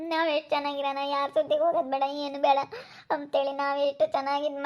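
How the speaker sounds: upset, holding back tears between halting phrases; tearful. Acted. Daughter complains to her father about the fights at home, tearful. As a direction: pour out the hurt tearfully, with long breaks. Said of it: "ಅಳ್ಬೇಡ ಸುಮ್ನಿರು, ಯಾಕೆ ಕಣ್ಣೀರ್ ಹಾಕ್ತೀಯ?".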